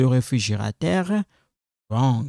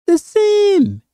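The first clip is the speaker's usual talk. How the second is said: "the same" shouted much higher and louder; sudden high shout; 5 dB louder than usual. Shown as a demonstration. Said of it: "So, the pronunciation".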